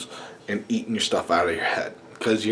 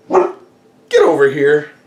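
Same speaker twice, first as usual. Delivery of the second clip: slow, louder call to the dog; 6 semitones higher, 9 dB louder, much slower than usual. Real, not acted. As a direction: drag the dog over with your voice. Calling the dog over.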